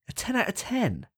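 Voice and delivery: high-pitched, astonished question; incredulous. Real, not acted. Co-host questioning a friend's top rating, incredulous. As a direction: leap up high in disbelief.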